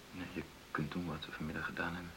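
soft, low and gentle; tender hushed comfort; soft reassurance. Acted. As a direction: coax her calmly, almost whispering.

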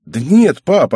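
quick high-pitched denial; dismissive daughter. A narrator's reading. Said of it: "отмахнулась Мизуки".

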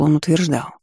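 usual speaking voice

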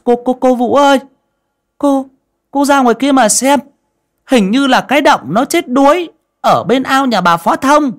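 put-on high, loud, stammering child voice; a frightened boy breaking terrible news; high loud stuttering panic; panicked boy. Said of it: "hoảng hốt".

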